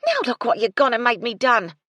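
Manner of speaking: loud, high furious blame; angry shouting. A narrator's reading. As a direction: lay into her. Cook blames the girl.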